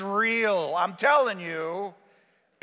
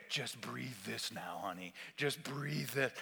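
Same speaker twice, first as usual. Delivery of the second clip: slow, low, hushed coaxing; acted soft, eerie nurse; sinister soothing; 7 semitones lower, 11 dB quieter, slower than usual. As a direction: coax the patient softly to breathe. Wife coaxing with concoction.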